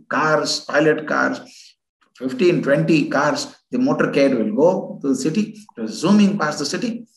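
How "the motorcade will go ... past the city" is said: usual speaking voice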